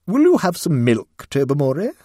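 strained. Acted. Lady Blemley offering milk to the cat, strained. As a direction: force politeness to a talking cat. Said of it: "in a rather strained voice".

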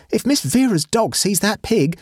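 high-pitched, alarmed maid's warning; voicing a flustered maid; maid's exclamation. A narrator's reading. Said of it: "exclaimed the maid".

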